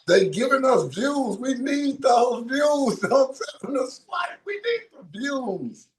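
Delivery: slow, high, fake tearful pleading; mock crying. Cohost begging her not to tell people to swipe, mock crying. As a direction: whine it like a hammed-up sob.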